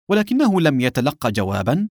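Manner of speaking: ordinary delivery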